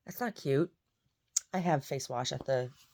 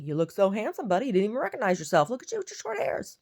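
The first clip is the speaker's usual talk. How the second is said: put-on higher, louder baby talk; praising the dog; 8 semitones higher, 4 dB louder than usual. Praising Goldie in the mirror, high baby talk. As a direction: gush over the haircut. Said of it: "I'm like".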